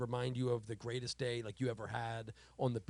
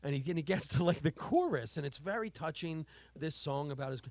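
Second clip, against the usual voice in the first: ordinary delivery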